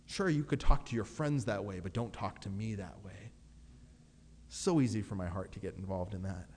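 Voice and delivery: usual speaking voice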